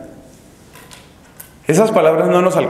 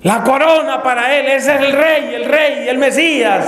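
high acclaiming cries; acted, high-pitched chanting yell; crowd shouting; 11 semitones higher than usual. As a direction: shout like a whole crowd hailing its king. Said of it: "empiezan a gritar todos".

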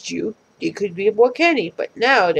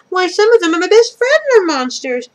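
excited character voice; 10 semitones higher, 7 dB louder than usual. Acted. Character voice in read story.

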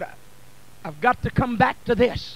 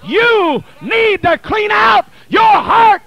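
building from steady to a loud, higher cry on blood; preaching at full force; 6 semitones higher, 5 dB louder than usual. Acted. Preacher urging the congregation, rising to full-force preaching. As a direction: whip the church up, climbing each line.

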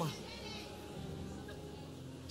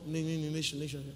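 stumbling over nation, mouth too full; na-na-na stuttered out before nation lands; mock stammer; 6 semitones lower, 7 dB quieter than usual. Shown as a demonstration. Preacher mocking a boaster who chokes on nation. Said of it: "mouth too big".

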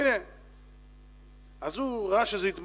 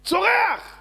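high, loud, drawn-out shout; full-voiced yell; screamed word; 12 semitones higher, 8 dB louder, much slower than usual.

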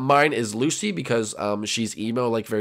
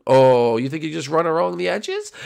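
ordinary delivery

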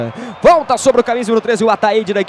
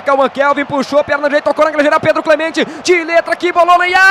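building from lively call to near shout; rising excitement; 6 semitones higher than usual. Real, not acted. Attack building toward goal.

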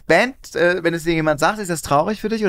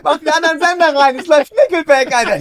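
high-pitched, hyped-up urging; mock excitement; 10 semitones higher, 5 dB louder than usual. Fan telling friend to switch stations for Nickelback, hammed-up excitement. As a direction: play the overexcited radio fan.

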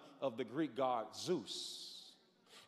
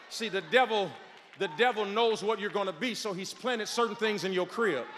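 loud, high, roaring sermon cadence; preaching at full force; 7 semitones higher, 21 dB louder than usual. Acted. Preacher rousing the congregation to be strong, shouting at full force.

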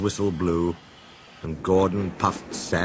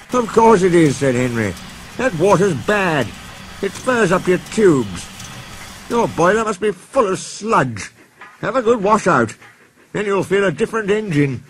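a high, loud busybody engine; Henry, bossy; 9 semitones higher, 4 dB louder than usual. Acted. Henry telling Gordon to wash out, high and bossy. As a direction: lay down the law confidently.

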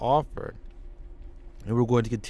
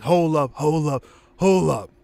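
louder hold up, hold up; Booker shouting in; 3 semitones higher, 6 dB louder than usual. Acted. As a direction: burst in yelling. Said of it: "Booker T comes out and says".

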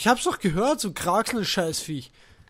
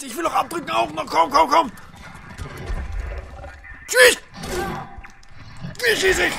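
frantic; 10 semitones higher, 3 dB louder than usual. Real, not acted. Can't work the gun mid-fight.

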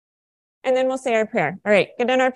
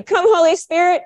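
higher, raised call to the group; yelled cue; 5 semitones higher, 4 dB louder than usual. Shown as a demonstration. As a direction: call it out for them to answer. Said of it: "So I yell".

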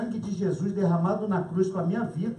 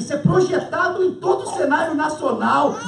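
excited; 7 semitones higher, 8 dB louder than usual. Real, not acted. Excited imagining fame.

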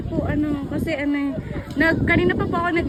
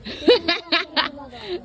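burst of laughter; 2 semitones higher than usual. Real, not acted. Laughs at dalaga joke.

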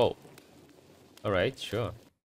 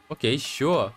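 ordinary talk, nothing marked

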